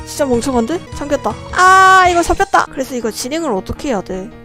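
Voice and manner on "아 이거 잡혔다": high panicked cry, then caught; panicking gamer. Real, not acted. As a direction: yelp in real fright. Caught by monster.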